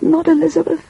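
high squealed protest; child's squeal. A narrator's reading. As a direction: squeal it like a little girl. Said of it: "squealed Elizabeth".

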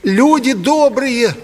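high, slow falsetto, trembling with agitation; quavering falsetto. A narrator's reading. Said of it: "прогласил он дребезжащим от волнения фальцетом".

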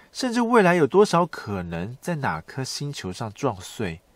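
ordinary talk, nothing marked

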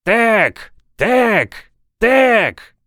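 high, drawn-out wail like a steamship siren; straining yell. A narrator's reading. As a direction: belt out the name as a loud, high chant. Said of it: "напрягся и завопил".

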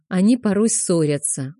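plain reading voice

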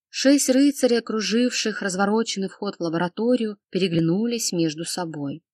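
ordinary delivery, nothing marked

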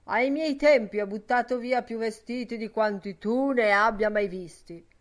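loud, indignant retort; furious growl. Acted. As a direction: keep the anger going, loudly. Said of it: "ringhiò furiosamente Ben Dollard".

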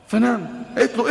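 normal talking voice

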